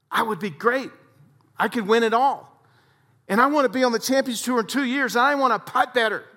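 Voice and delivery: high, earnest golfer voice; Jim's eager plea. Retold or imitated. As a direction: plead like a frustrated pro. Jim pleading, voiced higher.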